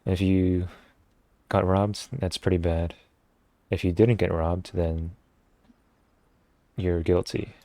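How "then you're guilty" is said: ordinary talk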